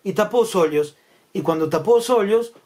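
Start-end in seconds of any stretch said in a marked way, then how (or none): none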